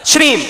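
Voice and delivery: "श्रीम" as a single high, falling call; mantra demonstration. Shown as a demonstration. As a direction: pitch "श्रीम" up high and let it drop. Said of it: "आप श्रीम बोलोगे तो".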